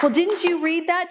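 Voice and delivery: retold outraged question; going off. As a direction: imitate an affronted woman demanding an answer. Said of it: "she just went off".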